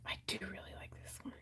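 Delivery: quick breathy whisper. Real, not acted. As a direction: murmur it to yourself. Hushed aside while husband present.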